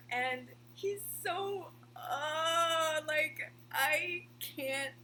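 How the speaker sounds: high, drawn-out, loud fangirl gushing; overwhelmed gushing. Real, not acted. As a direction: gush as if it's too much to bear. Overwhelmed gushing over lovable character.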